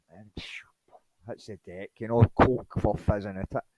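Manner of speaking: ordinary talk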